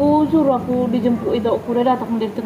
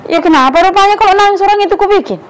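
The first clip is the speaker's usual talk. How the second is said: loud, high scolding; wife yelling at her husband; angry wife; 7 semitones higher, 8 dB louder than usual. Acted. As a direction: snap back at the husband at full volume.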